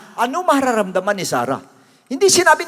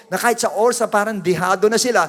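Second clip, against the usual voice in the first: normal talking voice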